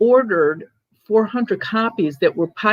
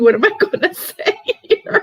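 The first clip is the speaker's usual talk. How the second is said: laughing; 13 semitones higher than usual. Retold or imitated. Panicked thought while retelling the story.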